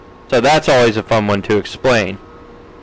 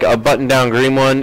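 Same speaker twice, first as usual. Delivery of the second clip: ordinary delivery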